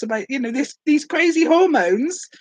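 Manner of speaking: usual speaking voice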